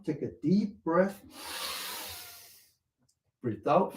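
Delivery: calm trainer calling out the moves; exercise guidance. Acted. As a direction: coach them through each breath. Guides a breathing exercise.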